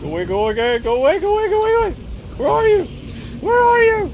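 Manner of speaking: high, very loud shrieking; panicked screaming. Real, not acted. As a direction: scream for your life. Mauled by tiger, screaming.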